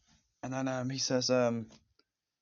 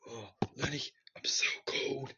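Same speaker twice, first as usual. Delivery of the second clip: weak, whispered dying voice; hushed, feeble imitation of last words; Frankie; 4 dB quieter than usual. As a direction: whisper it faintly, acting out a dying man. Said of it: "Frankie says".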